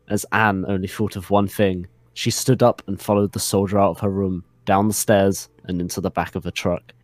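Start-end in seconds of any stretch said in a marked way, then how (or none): none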